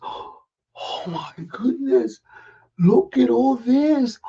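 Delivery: drawn-out amazed oh; slow awe. Acted. Ricky awed by the book he found. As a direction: breathe it out in wonder.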